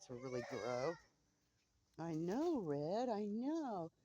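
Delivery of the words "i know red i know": talking to a pet. Real, not acted. Talking to the hen Red.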